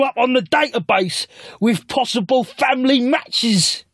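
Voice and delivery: very high, slow, shouty put-on voice. [name] explains the DNA kit in a high slow shout. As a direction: pitch it like a squeaky salesman.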